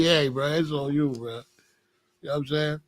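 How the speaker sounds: normal talking voice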